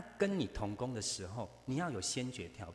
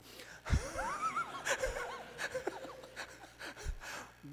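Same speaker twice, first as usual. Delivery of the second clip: big high laugh bursting out; a real howl of laughter. Real, not acted. Laughs at own mock humility.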